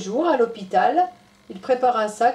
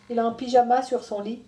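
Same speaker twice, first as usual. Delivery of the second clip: ordinary delivery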